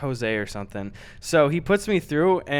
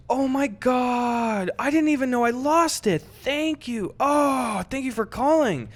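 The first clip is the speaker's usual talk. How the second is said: higher, gushing grateful owner; gushing thanks; 8 semitones higher than usual. Retold or imitated. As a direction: overdo the relieved thank-yous. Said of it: "And he goes".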